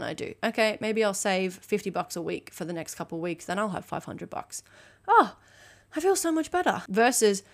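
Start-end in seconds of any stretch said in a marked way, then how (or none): 5.1-6.8 higher-pitched sigh of relief; acted relief. Coach acting out solving a money problem, relieved. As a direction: let the worry go, voice lifted.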